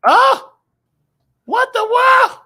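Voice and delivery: sudden very high yelp; real shock at the screen; startled shout. Real, not acted. Shocked by something on screen, a high startled shout. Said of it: "What in the world is this doing?".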